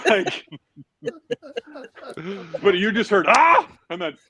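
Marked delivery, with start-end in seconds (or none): 3.3-3.7 high, loud yelp; falling scream. Retold or imitated. Friend retelling the stair fall, high loud yelp.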